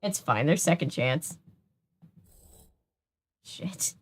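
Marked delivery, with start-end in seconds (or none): 3.4-3.9 whispered curse. Real, not acted. Quiet curse after a mistake.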